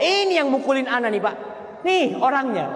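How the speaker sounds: hint of a wife telling on him; lightly voiced accusing wife; mock accusing wife. Wife points out husband.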